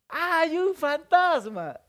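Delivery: slow, high, loud group cry; all yell at once in terror; a hammed-up shout of fear. Disciples shouting together in fear.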